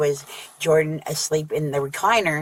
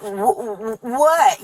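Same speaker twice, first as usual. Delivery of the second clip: mock startled stammer; 6 semitones higher, much slower than usual. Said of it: "And he was like".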